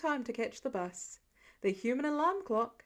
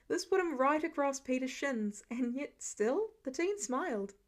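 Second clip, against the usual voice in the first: ordinary delivery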